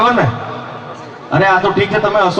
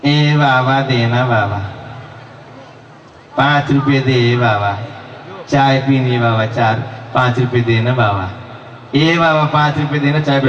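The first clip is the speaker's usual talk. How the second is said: lower, nagging beggar's plea; whining beggar; 4 semitones lower than usual. Retold or imitated. Beggar at Bhadrakali temple pestering the comedian, whining. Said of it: "ભિખારીઓ ... mimicry".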